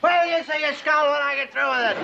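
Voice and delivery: furious, ready to crack a skull; outraged threat. Acted. Captain threatening the phony doctor, angry shout.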